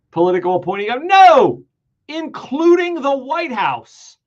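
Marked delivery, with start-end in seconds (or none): 1.0-1.6 a loud, high shouted "No!"; forceful shout; emphatic no. Real, not acted. Emphatic retort to own question. 2.1-4.2 high and slow, every word weighed; dramatic slow reading; slow emphasis. Shown as a demonstration. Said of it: "every word you could pause over".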